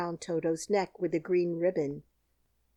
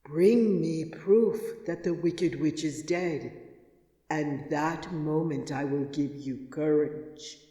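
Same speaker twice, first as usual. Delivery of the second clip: slow, weighty, louder commands fading low; 3 semitones lower, 4 dB louder, slower than usual. A narrator's reading. Oz setting the lion's task, slow then fading low. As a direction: lay down the terms slowly, then let it sink.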